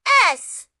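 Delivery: loud high burst of joy; triumphant yes; excited cheer. A narrator's reading. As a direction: shout it out triumphantly. Narrator cheers.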